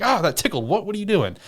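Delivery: high, playful and innocent; playful giggly girl. Retold or imitated. Shadow poked with a knife, high playful tickled reply. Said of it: "she's like".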